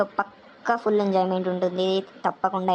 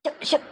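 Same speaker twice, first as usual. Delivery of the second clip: shooing hiss; 8 semitones higher than usual. Acted. Shooing the chickens away.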